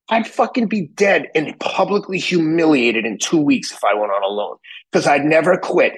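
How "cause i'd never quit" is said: normal talking voice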